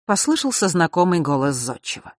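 ordinary delivery, nothing marked

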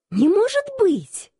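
high astonished gasp of disbelief; surprised. Acted. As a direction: blurt it out in shock. Shock on tasting beer.

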